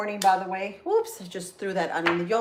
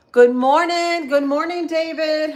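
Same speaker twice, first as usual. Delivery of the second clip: higher and louder, beaming hello to a viewer; excited greeting; 8 semitones higher, 10 dB louder than usual. Real, not acted. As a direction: call out a big happy good morning. Greeting viewer David on stream.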